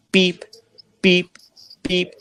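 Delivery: high beeps in quick succession. Retold or imitated. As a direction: beep like a monitor speeding up. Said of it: "it got louder and faster".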